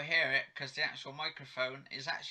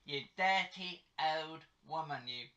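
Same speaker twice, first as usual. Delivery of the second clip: put-on London accent; 2 semitones higher than usual. Teasing mum for breaking wind, London accent. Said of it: "doing in a London accent".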